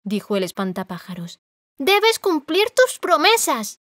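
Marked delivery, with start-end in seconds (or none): 1.8-3.8 much higher, louder and slow; exclaiming. A narrator's reading. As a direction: demand it, high and forceful. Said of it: "exclamó Dorothy". (exclamación aguda, fuerte y lenta)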